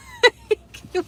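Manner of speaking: high squealing laugh; burst of laughter. Real, not acted. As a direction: let out a high shriek of laughter. Laughing at her own story.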